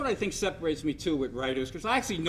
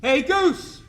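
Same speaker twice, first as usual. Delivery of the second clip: a retold high, loud shout across the clubhouse; calling out again; 10 semitones higher, 8 dB louder than usual. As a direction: yell his name across the room one more time. Munson calls across the clubhouse.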